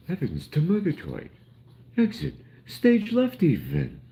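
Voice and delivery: hammed-up theatrical cartoon cat; Snagglepuss. Imitating a cartoon lion's catchphrase, high and hammy. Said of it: "Snagglepuss used to say".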